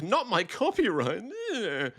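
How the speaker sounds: high put-on wail ending in a groan; mock outrage. Republicans suddenly hurt themselves, mock-outraged whine. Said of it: "suddenly they're like".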